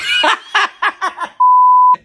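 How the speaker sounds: long, loud, high screech of laughter; shrieking laugh. Real, not acted. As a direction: lose it completely and shriek. Cracking up at own joke.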